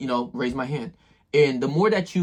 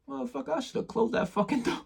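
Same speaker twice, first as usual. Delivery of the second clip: higher and much softer, a private mutter; hushed inner curse; 10 semitones higher, 8 dB quieter than usual. Retold or imitated. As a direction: curse under your breath in a thin, pinched voice. Imagined thought after ignored courtesy.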